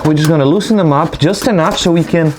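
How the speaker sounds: talking while lying on your back under a car. Real, not acted. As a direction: voice coming up from beneath a car. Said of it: "so I'm under the car now".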